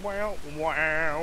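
ordinary talk

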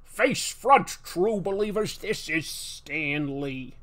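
loud Stan Lee impression that winds down slowly. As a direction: announce it like a comic-book huckster. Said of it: "His Stan Lee voice is great".

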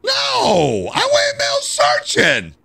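high, slow shouted wail; exasperated yell. Real, not acted. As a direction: wail it out in disbelief. Yelling at game.

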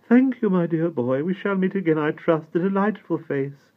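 high, loud gracious duchess; a great lady charmed by the boy; grand duchess. A narrator's reading. The Duchess thanks Simon.

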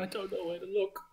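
a desperate little man begging a dragonborn for help; panicked father NPC. Acted. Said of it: "He looks up at you and goes".

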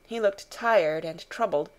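usual speaking voice

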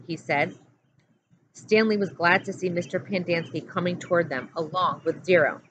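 ordinary delivery, nothing marked

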